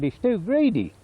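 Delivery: high, lightly acted old man; lightly voiced old bloke. Peter quoted ranting about buyers.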